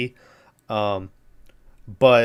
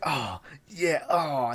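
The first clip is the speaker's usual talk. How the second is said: mock moaning; 3 semitones higher, 6 dB quieter, much slower than usual. Imitating Bluto's pleasure at the car.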